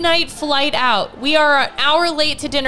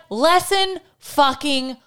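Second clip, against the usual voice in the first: dramatic; much slower than usual. Acted. Bitter self-blame punchline.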